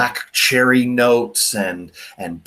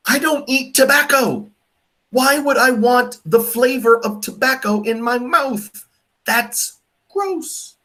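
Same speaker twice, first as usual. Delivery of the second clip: much higher, outraged at tobacco in wine; disgusted disbelief; 12 semitones higher than usual. Real, not acted. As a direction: pitch it way up, appalled. Ranting about tobacco notes.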